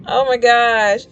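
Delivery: ordinary delivery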